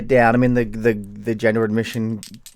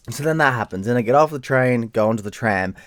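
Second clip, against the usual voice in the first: usual speaking voice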